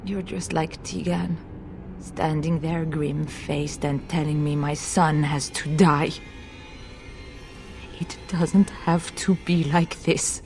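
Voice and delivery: low, soft, bitter accusation; bitter reproach. Acted. Mother bitterly confronts the others.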